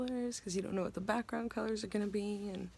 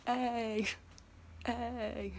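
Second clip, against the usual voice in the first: funny face voice; 2 semitones higher than usual. Shown as a demonstration. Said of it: "I got a pretty funny one. Ready?".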